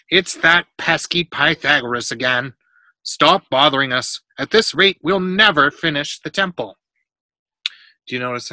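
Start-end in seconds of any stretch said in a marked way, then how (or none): 0.1-6.8 louder, emphatic scolding; frowning annoyance. A narrator's reading. Workman scolding a pesky boy, annoyed. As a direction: shoo off a pest crossly. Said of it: "Pepros frowns".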